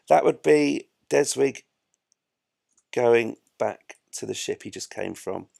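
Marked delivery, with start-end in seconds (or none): none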